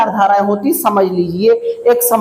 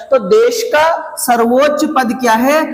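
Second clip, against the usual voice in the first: ordinary talk, nothing marked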